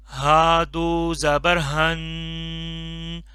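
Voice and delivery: very slow, drawn-out drill for repeating; slow drilled recitation. Shown as a demonstration. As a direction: stretch each syllable for the class to echo. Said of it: "मैं पढ़ता जाऊंगा". (बहुत धीमे, खींचकर पढ़ाना)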